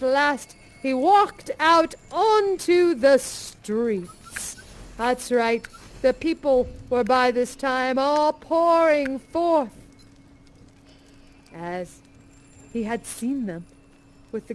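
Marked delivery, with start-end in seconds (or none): none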